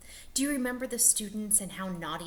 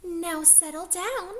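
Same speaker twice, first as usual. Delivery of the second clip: high, sweet teacher voice; a sugary gentle teacher asking for calm; 9 semitones higher than usual. Acted. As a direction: coax the class kindly and gently. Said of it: "said Miss Nelson in a sweet voice".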